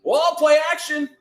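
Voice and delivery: high, loud, stretched-out cheer; excited call. Real, not acted. Reacting live to the play.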